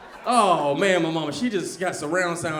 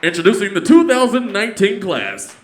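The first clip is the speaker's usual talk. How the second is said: slow, louder announcer read; graduation announcer; 9 dB louder, slower than usual. Retold or imitated. Announcer at a graduation ceremony, slow and formal. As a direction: announce it over a school PA.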